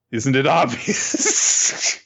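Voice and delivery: slow, drawn-out mocking answer; cold smug reply. Friend voicing Isabella coolly telling Ratri she betrayed him, smug. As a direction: let it drip with smug calm. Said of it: "And Isabella says".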